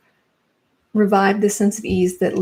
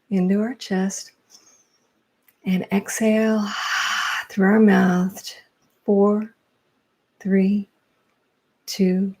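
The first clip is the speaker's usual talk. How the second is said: measured delivery with long pauses; guided meditation. Real, not acted. Instructor guiding listeners through morning breathing, guided meditation.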